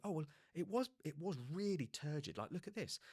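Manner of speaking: a touch of boastful eagerness; eager showing off. Retold or imitated. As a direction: prove how bad it was. Showing off the blister.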